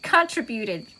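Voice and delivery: southern "contributed", drawn out with the first syllable hit as CON; slow southern slip on "contributed", saying CON-tributed; southern accent. Real, not acted. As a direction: stretch "contributed" in a southern accent, stress up front. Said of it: "I got my southern accent again".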